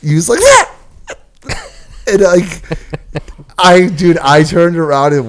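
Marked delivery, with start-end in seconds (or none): 0.4-0.8 put-on shrill girlish shriek; high-pitched scream. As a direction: scream like a startled little girl. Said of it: "screamed like a little girl".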